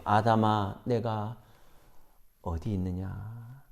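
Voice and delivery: low, soft and slow, fading to almost nothing; gentle voice of God. Acted. God softly asks hiding Adam, slow and tender. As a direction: ask tenderly, already knowing the answer. God's knowing question to Adam.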